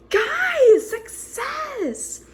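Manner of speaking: high, loud cry of guys, success; triumphant. Real, not acted. Vlogger telling viewers baguettes worked, triumphant cry. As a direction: announce the win to the viewers.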